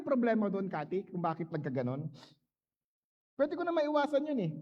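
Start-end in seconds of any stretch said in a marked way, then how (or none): none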